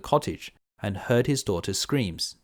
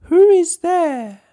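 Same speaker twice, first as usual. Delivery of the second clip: much higher, louder, slow quavery granny; old granny voice; 11 dB louder, much slower than usual. Acted. Grandmother calling through the door, high and slow. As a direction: call out like a frail old grandmother. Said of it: "called the grandmother".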